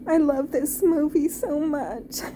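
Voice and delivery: real, overcome with love for the film; crying. Real, not acted. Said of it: "I'm getting choked up".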